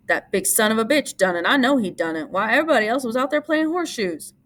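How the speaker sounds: working up to rage; angry cry. A narrator's reading. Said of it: "he cried".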